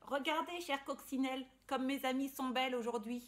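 loud, high happy call; proud and delighted. Acted. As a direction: show off the flowers joyfully. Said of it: "répondit-elle".

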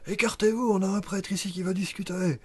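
high-pitched shout to the lads; called-out cry. Acted. Garde crie à ses gars de s'écarter, cri aigu. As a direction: cry out to clear a path. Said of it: "il fait un cri".

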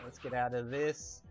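ordinary talk

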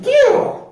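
very high, loud mock spitting noise; sudden high-pitched "pew"; loud spit-out. A narrator's reading. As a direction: squeal a cartoon spit of disgust. Crew spits out the food.

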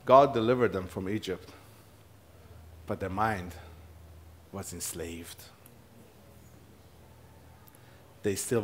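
soft, low, with long pauses; grave, hushed preaching. Real, not acted. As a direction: let each word sink in quietly. Grave point made after laughter.

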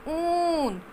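ऊन with a long high ऊ; teacher stretching the ऊ; long vowel demo. Shown as a demonstration. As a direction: hold the ऊ of ऊन long. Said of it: "इसमें लंबी sound आ रही है".

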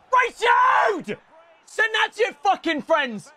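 high-pitched crowing yell; taunting shout. Real, not acted. Gamer crowing at an opponent, taunting shout. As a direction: yell it high at a beaten rival.

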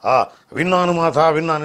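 loud, booming baritone actor impression; loud mimicry of a film baritone; SV Ranga Rao. Shown as a demonstration. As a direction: project it like a grave screen elder. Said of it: "SV Rangarao అనగానే".

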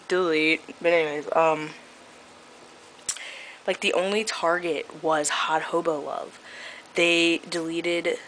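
ordinary talk

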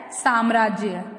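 "साम्राज्य" opening on a long "saam"; correct pronunciation. Shown as a demonstration. Teacher answering what it is, correctly pronounced. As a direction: stretch the first a of "साम्राज्य". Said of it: "क्या होता है?".

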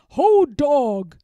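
booming troll demand; troll cry. Acted. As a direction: bark it like a greedy troll. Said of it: "they cried".

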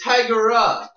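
Tigera in three syllables, loud and drawn out; three-syllable name. Real, not acted. Insisting on a cat name to friends, Tigera in three drawn syllables. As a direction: stretch Tigera out with an extra middle syllable. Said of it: "not Tigera, it's Tigra".

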